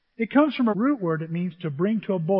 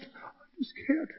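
breathy mock whimper, slow; hushed mock despair; whisper; 9 semitones higher, 8 dB quieter, slower than usual. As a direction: whisper it like you're giving up. Mocking a hurt churchgoer.

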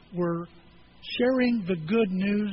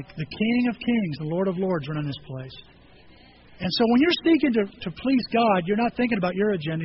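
usual speaking voice

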